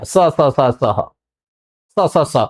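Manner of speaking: low, offhand run of identical स sounds; undifferentiated sibilants. Retold or imitated. Teacher mimicking how we lump all letters into one, careless identical स. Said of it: "हम इसी के उच्चारण में सबको लपेट लेते हैं".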